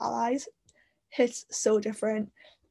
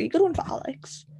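whispered aside; 5 semitones higher than usual. Shown as a demonstration. Incredulous aside about the name.

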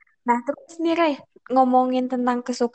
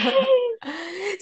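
amused laugh; 9 semitones higher than usual. Real, not acted. Interviewer laughs at her pause.

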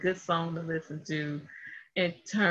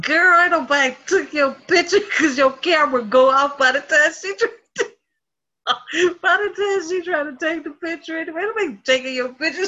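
loud, laughing retort to mom; teasing shout back; 9 semitones higher, 6 dB louder than usual. Retold or imitated. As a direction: shout her down with a grin. Arguing back at her mother.

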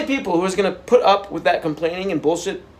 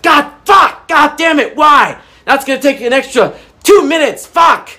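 high, loud mock road-rage shout; 11 semitones higher, 11 dB louder than usual. Vlogger acting out a driver cut off in traffic, loud mock rage. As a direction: blow up at the driver who cut you off, hammed-up. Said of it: "you're like".

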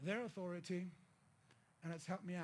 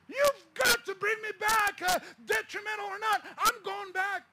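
passionate pleading; 11 semitones higher, 12 dB louder than usual. Retold or imitated. Refusing to accept the pastor's answer, shouted plea. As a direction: shout the plea, insisting you're going back. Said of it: "I'm passionately, passionately".

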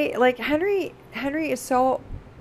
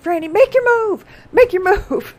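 much higher and louder, fast; amped-up yell; 12 semitones higher, 10 dB louder than usual. Retold or imitated. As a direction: pump her up, shouting. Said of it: "getting amped up".

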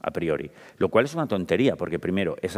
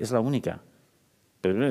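ordinary talk

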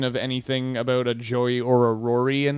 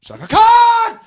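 shout; 13 dB louder, slower than usual. Acted. Loud shout of the name.

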